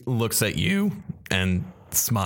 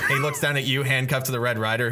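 usual speaking voice

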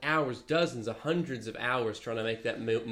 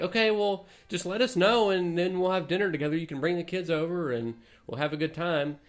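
much higher, bright and eager voice; cheery neighbor; 7 semitones higher, 4 dB louder than usual. Retold or imitated. Neighbors taking the brush-off, in a much higher, overly cheery voice. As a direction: chirp it out like a too-friendly neighbor. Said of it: "they're like".

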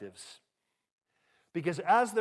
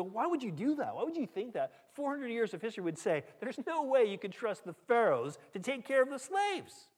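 higher, exasperated why-would-you; incredulous disbelief; 7 semitones higher than usual. Retold or imitated. Preacher reacting to the Hebrews' trust in Pharaoh, incredulous. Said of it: "I read this and go".

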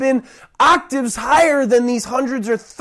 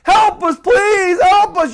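high screamed plea; yelling louder; 10 semitones higher, 4 dB louder, slower than usual. Acted. Castaway screaming to the passing boat, desperate yelling. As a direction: scream it with all you are. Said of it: "You're gonna yell even louder".

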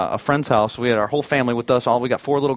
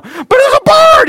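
high, loud, slow cry of alarm; mock a wife shrieking in fright; panicked shriek; 10 dB louder, slower than usual. Wife retold panicking over a bird, high loud shriek.

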